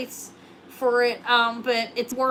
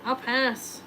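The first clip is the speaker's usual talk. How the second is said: ordinary talk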